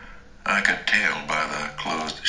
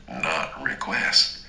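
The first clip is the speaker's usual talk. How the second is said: usual speaking voice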